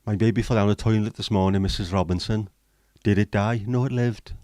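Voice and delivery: a hint of a Welsh accent; lightly done Welsh accent for a dad joke. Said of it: "Horrific Welsh accent".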